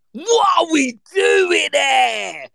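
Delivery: high, loud disbelieving shout; outraged shout. Real, not acted. Reacting to the sofa story with a high outraged shout. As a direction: cry out in outrage.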